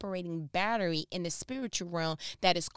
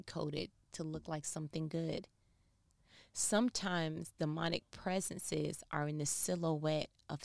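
much softer, slower and lower; soft earnest warning; 3 semitones lower, 7 dB quieter than usual. Real, not acted. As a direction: draw them in quietly.

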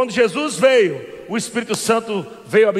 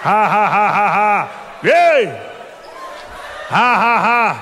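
holy laughter; 4 semitones lower, slower than usual. Acted. Preacher laughing at the devil, rhythmic ha-ha. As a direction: laugh out the joy deliberately.

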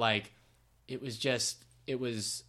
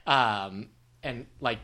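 ordinary talk, nothing marked

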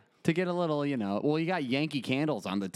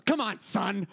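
mom voice; 7 semitones higher, slower than usual. Retold or imitated. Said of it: "and she goes".

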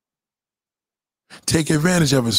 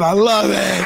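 I love it cried out high and louder; excited mock moan, higher; mock ecstasy; 6 semitones higher, 3 dB louder, slower than usual. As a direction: push it up into a hammed-up peak. Repeated aroused woman line.

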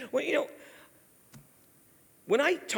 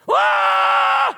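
very high, loud "ah" yell; hammed-up outburst at full voice; mock yell; 12 dB louder than usual. Zacchaeus suddenly yelling at Jesus, hammed up. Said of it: "He just yells at Jesus".

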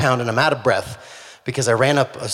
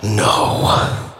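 breathy whispered no, under the breath; whispered dismay; 5 semitones lower, 3 dB louder than usual. Acted. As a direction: whisper a crushed no. Hearing the turkey walk away.

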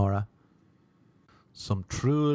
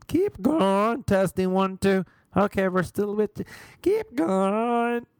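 donkey testing a microphone; 9 semitones higher, slower than usual. Retold or imitated. Yoga teacher on her headset to the class, donkey-like high bray. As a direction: bray it like a donkey checking a mic. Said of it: "Like a donkey testing a microphone".

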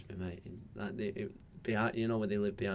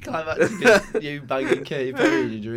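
laughing tease; 6 semitones higher, 9 dB louder than usual. Real, not acted. Teasing jab, laughing.

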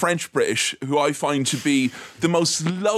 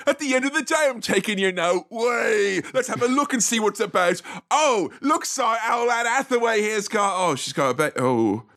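mock cockney workers; 6 semitones higher than usual. Mocking factory workers' banter.

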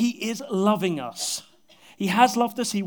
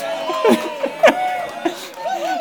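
big laugh; 7 dB louder than usual. Real, not acted. Laughing at his own accent.

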